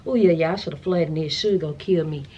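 usual speaking voice

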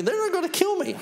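mock-casual list topping out high; retold line pitched comically high; high-pitched climax. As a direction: jump far up in pitch on the last blow. Jesus acted listing his coming fate.

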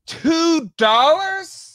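drawn-out high incredulous question; can't believe the price, stretch it way up high; slow, high astonished echo of the price; stunned disbelief. Real, not acted. Hearing a figure cost two bucks.

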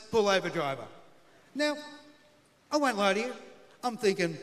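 normal talking voice

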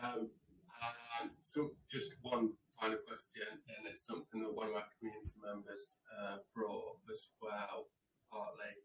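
quiet, distant mic sound; speaking far from the microphone. Real, not acted. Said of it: "put your mic next to your mouth".

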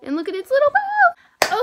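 very high, loud delighted squeal; excited squeal. Real, not acted. Pointing out the squishy's bow to viewers, squealing. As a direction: shriek with delight at the tiny bow. Gushing over the little bow.

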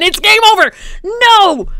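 high, loud cry of defeat; distraught shout. Real, not acted. Gamer facing game over, distraught shouting. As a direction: shout it out in despair.

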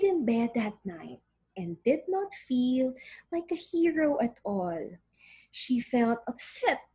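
expressive storybook reading; story narration. Acted. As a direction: read it like a picture book. Reads story aloud to class.

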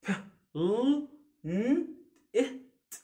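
ب ل ن إ ت each said apart with pauses; teacher segmenting planet; spelled sound by sound. Shown as a demonstration. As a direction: break planet into single sounds.

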